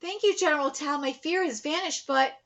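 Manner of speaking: very soft read-aloud farewell; quiet, formal thanks, understated; lightly voiced grateful heroine. Heroine bids General Tao farewell, lightly voiced and hushed.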